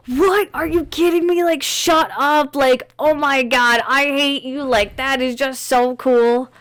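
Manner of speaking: high, loud mock disbelief, stretched out slowly; mock jealous outrage. Telling a friend how she reacted to the iPhone news, jealous shriek. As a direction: replay the envious shriek over the new phone. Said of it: "I got so mad".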